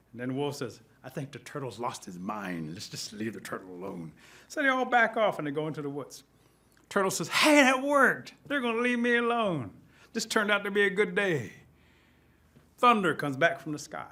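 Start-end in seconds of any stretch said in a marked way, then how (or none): 1.0-4.1 low, soft confiding voice; wary wolf aside. Acted. Wolf advising pack that turtle has lost his mind, hushed. 7.3-11.5 high, gleeful surprise; delighted turtle. Acted. Turtle seeing bullies leave him alone, delighted.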